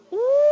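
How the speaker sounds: high imitated wolf howl. Shown as a demonstration. Teacher imitates a wolf to set a night scene, high howl. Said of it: "ada lagi yang suara seperti ini".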